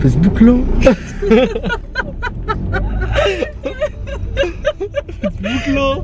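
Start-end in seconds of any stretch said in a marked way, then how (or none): 0.6-5.4 breathless high laughter; high laughing fit. Real, not acted. Teasing about Facebook, laughing fit. 5.4-6.0 high, teary laugh through the words. Real, not acted. Repeats it while laughing.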